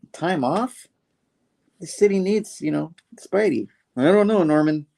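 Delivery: high-pitched acted disbelief; protesting. Peter balking at Norman's advice to rest, high acted protest. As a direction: imitate a hero objecting, voice pitched well up.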